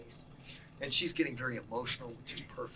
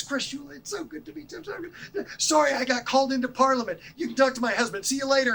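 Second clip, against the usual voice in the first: much higher and louder, a rushed gushing goodbye; hurried politician; 11 semitones higher, 9 dB louder than usual. Retold or imitated. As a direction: play her breezing past in a hurry. Politician rushing out, apologizing.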